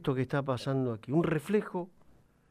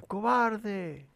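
softer, distant echo of the insult; 7 semitones higher, 3 dB quieter than usual. A narrator's reading. The mountain echoes the boy, distant echo. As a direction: send it back from far away. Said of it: "del otro lado le contesta".

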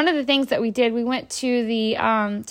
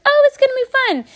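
high, sugary mock reassurance; fake cheerful; 13 semitones higher, 4 dB louder than usual. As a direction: sell the ride to a nervous kid. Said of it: "We're like".

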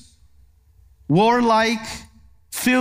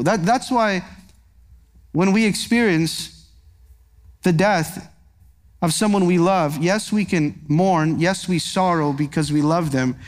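tender comfort; 4 semitones lower than usual. Real, not acted. Preacher comforting mourners, low tender reassurance. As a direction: comfort the grieving gently.

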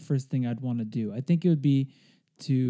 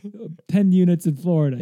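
yelling across the room; 4 semitones higher, 5 dB louder than usual. Pretend. Joins the mock shouting at dad.

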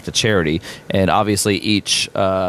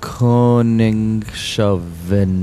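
very slow, syllable by syllable; over enunciating; much slower than usual. Shown as a demonstration. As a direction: stretch out each syllable carefully.

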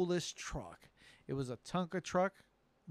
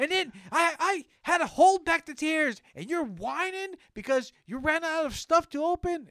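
high, shouted comic indignation; angry rant; 10 dB louder than usual. Acted. Father rails at whining kids about his childhood, angry. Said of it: "it kinda made me angry".

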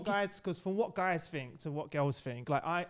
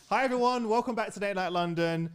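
upbeat presenter greeting, a bit higher and louder; podcast host intro; 4 semitones higher, 4 dB louder than usual. Acted. Host finally doing the intro to listeners, upbeat presenter. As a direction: welcome listeners like a show host.

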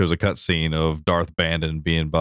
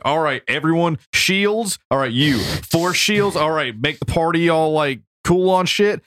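commanding the party; 9 semitones higher, slower than usual. Retold or imitated. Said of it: "I was like".

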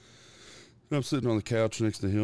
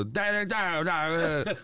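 put-on toddler babble; 8 semitones higher, slower than usual. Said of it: "And he'd go".

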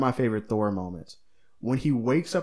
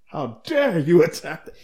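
appalled, grand theatrical outrage; hammy stage actor; 5 semitones higher, 4 dB louder than usual. Retold or imitated. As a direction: declaim it like a wounded royal. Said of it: "he looked so appalled at it".

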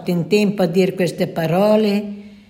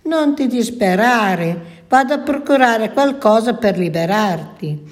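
ordinary delivery

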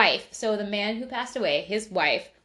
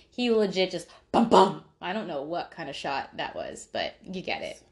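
normal talking voice

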